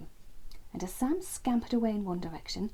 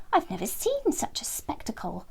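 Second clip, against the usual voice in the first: breathy, a bit higher character voice; a hint of an awed friend; lightly voiced awed friend; lightly voiced Sam; 5 semitones higher than usual. A narrator's reading.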